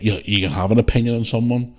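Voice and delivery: ordinary talk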